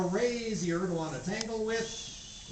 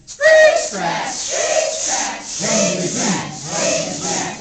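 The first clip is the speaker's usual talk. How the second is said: shrill screeching and scratching rocker noises, much louder; rocking chair screech; 14 dB louder, much slower than usual. Acted. Storyteller making Grandma's rocking chair noises, loud shrill screeches. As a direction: screech like a creaky chair scraping. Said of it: "Grandma is sitting there ... going".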